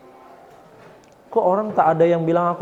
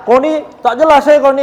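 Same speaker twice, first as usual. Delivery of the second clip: higher and louder, a rude accusing jab; rude speech; 8 semitones higher, 6 dB louder than usual. Shown as a demonstration. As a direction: snap at a friend rudely to show bad manners. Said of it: "tapi kalau tak baik".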